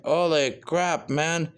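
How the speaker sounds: ordinary talk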